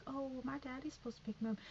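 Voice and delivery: put-on a small girl chirping out the line quickly; imitating a little girl.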